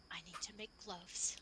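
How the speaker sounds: whispered, low and soft; quiet whisper. Acted. Blacksmith blinking and smiling, whispering to the party. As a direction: whisper it with a frozen smile. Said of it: "is very quiet as he just kinda".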